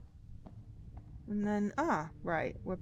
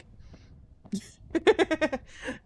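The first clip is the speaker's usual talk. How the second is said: loud laugh; 10 semitones higher, 5 dB louder than usual. Real, not acted. Laughing at own joke.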